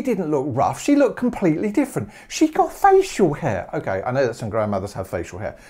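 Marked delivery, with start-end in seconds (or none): 2.3-3.7 mock horror. Real, not acted. Horrified description of wolf granny.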